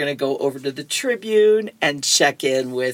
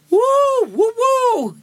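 high-pitched "woo" cheer; celebratory whoop; 3 dB louder than usual. Acted. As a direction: whoop like a kid who won. Boy celebrates winning the bargain.

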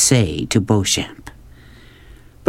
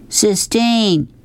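calling out; 11 semitones higher than usual. A narrator's reading. Rob running after Sistine, calling out high. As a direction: call the name high, stretched out. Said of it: "he shouted as he ran".

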